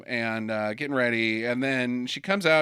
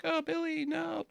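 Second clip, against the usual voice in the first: mock dismay; 7 dB quieter than usual. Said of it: "She's like".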